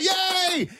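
high, bright burst of a cheer; proud mum cheering from the scorer's bench. Retold or imitated. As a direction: celebrate her finally catching it. Cheering as daughter grabs ball.

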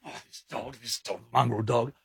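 very quiet grumble to oneself; cranky muttering. Retold or imitated. Pacing round the ute cursing a dog, muttering crankily. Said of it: "I was pacing like this".

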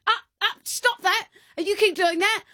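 high, scolding mother voice; scolding mum. Retold or imitated. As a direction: tell the kid off, voice up high. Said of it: "Violet's going".

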